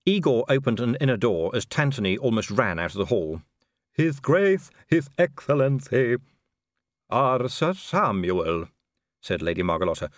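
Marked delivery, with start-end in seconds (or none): 3.9-6.2 servile butler announcing, higher and louder; creepy servant's announcement; Igor announcing. Acted. 7.1-8.7 slow, gracious aristocratic greeting; an elegant vampire lady, slow; Lady Margalotta. Acted.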